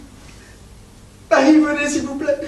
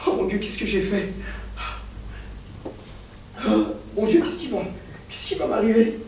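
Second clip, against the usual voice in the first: hushed dread; 5 semitones lower, 9 dB quieter, faster than usual. Acted. Professor panics after murder.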